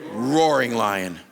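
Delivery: roaring lion stretched slow and loud; slow emphasis. Acted. Preacher reveals the devil's image to men, slow stress. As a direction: lean hard on "Roaring lion" word by word.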